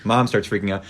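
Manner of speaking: normal talking voice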